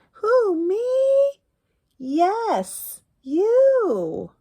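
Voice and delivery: high, loud storybook voices; high playful picture-book voices; character voices. Acted. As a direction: act out the question and answer brightly.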